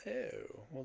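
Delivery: ordinary talk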